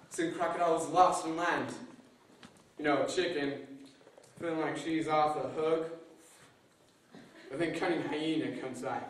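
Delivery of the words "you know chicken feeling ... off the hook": ordinary delivery, nothing marked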